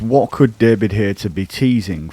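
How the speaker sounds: ordinary talk, nothing marked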